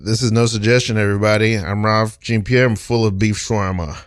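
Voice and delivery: ordinary talk, nothing marked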